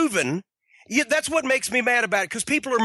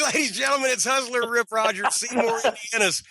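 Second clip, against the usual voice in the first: ordinary talk, nothing marked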